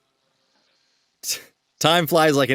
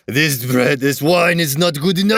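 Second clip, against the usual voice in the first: mock French snob. Mocking Parisians who reject bread, hammed French. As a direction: sniff at the bread like a snooty Parisian. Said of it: "You sound like a pretty good Frenchman".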